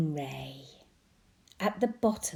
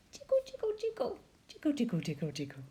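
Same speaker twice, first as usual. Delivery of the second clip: squeaky jiggle; 9 semitones higher, 3 dB quieter, much faster than usual. Acted. Jellyfish jiggling.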